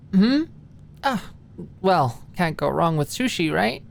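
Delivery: high startled hmm then breezy; caught off guard. A narrator's reading. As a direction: snap back from daydreaming.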